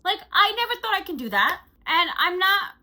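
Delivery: high, loud, fast excitement; excited. Real, not acted. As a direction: gush excitedly. Excited, nearly crying.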